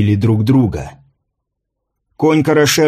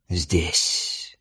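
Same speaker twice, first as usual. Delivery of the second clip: low, soft hiss with whistling s; 6 semitones lower, 11 dB quieter than usual. Acted. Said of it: "раздался свистящий голос". (свистящий голос)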